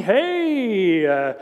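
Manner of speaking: lightly voiced jeering mocker; mocking crowd. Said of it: "people mocking at him".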